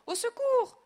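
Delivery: very high, very loud scream; screaming for help. A narrator's reading. Wife stripped by the robot, screaming for help. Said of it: "crie la femme du directeur".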